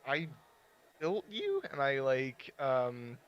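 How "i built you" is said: curious, rising question; inquisitive. Acted. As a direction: ask it wonderingly, pitched up. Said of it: "a inquisitive tone".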